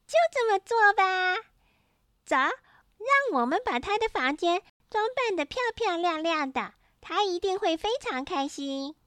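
high-pitched excited little sister; calling out loudly. A narrator's reading. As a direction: cry out eagerly in a high girl's voice. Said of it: "妹妹黛西高声喊".

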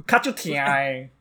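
ordinary delivery

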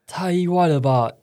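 high, loud astonishment; surprised. Acted. Woman reacts to the surprise gift bed, astonished and loud. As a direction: burst out in disbelief.

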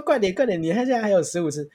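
put-on teasing urgency to wear the kid out; mock eager urging. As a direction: push the tired kid with fake cheer.

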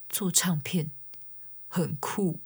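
soft whisper, slow and understated; whispered doctor. Retold or imitated. Doctor telling the singer her job is cool, whispered. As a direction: imitate the calm old doctor whispering his approval. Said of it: "讲话很小声".

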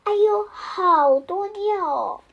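high, loud, drawn-out astonishment; wow-so-much storybook outburst; amazed exclamation. A narrator's reading. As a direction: exclaim slowly in comic amazement. Child amazed at so much pee.

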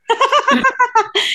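squeaky embarrassed laugh; very high giggle. Real, not acted. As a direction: laugh off a secret. Cuts herself off, laughs. (害羞地尖声笑)

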